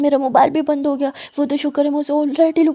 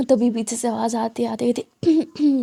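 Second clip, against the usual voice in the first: ordinary delivery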